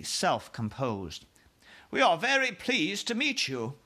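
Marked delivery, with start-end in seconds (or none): 1.9-3.8 high, gracious royal voice; queen voice. Acted. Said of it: "she said".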